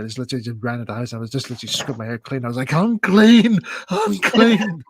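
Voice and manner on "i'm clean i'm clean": high, loud, joyful shout; relieved cry. Retold or imitated. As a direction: celebrate being clean at last. After scrubbing his hair clean.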